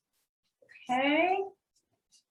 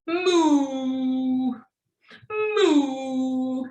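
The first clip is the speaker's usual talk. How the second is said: playful mooing like a cow; teacher mooing as the cow to make the children laugh; silly cow moo. Pretend. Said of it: "you're being a silly goose".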